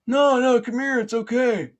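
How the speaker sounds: very high, loud insistent shout; yelling police officer. Retold or imitated. Police officer coaxes swimmer over, yelling. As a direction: holler reassurance across the pond. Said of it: "I hear somebody yelling".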